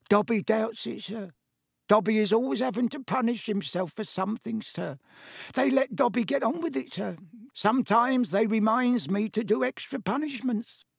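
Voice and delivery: high, squeaky little servant; Dobby, elf voice. A narrator's reading. Elf excuses punishing himself, high-pitched.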